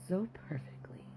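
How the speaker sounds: a soft whispered afterthought. Real, not acted. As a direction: let it fade into a whisper. Smitten memory.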